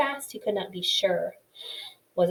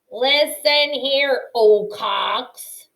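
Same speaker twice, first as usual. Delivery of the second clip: slow, louder stern warning; sharp snap; 4 semitones higher, 6 dB louder, much slower than usual. A narrator's reading. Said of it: "Earth snapped".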